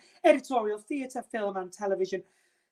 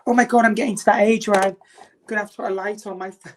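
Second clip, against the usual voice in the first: usual speaking voice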